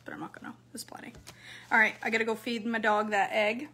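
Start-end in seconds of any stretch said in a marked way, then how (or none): 0.0-1.1 soft whispered aside; whispering. Real, not acted. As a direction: drop to a whisper. Quiet aside while eating.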